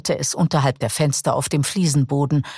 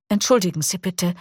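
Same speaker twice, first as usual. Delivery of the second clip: plain reading voice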